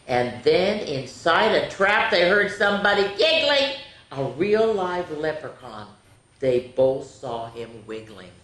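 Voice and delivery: animated rhyming read-aloud; sweet picture-book voice for kids; story narration. A narrator's reading.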